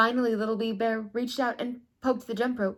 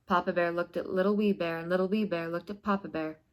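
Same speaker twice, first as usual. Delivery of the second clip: plain reading voice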